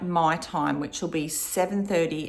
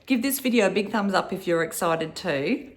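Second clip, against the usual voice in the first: normal talking voice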